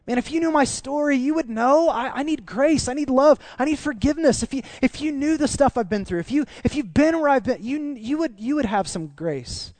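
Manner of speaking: high-pitched, desperate-sounding appeal; impassioned plea. Acted. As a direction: plead for understanding, voice riding high. Acted plea for grace.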